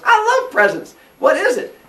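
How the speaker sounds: put-on high, loud excited kid voice; childish glee. Cronus, delighted by a present, childish. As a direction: squeal like a child at a gift.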